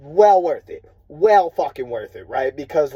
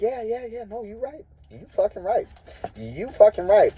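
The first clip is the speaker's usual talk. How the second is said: higher, lightly voiced placating staff; mock agreement; 7 semitones higher than usual. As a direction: parody the yes-men agreeing. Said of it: "they're all like".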